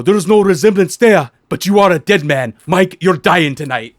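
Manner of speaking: playful impression of a wrestler's death threat; hammed-up Oba threat. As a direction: growl it out like a heel promo. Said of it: "he's all".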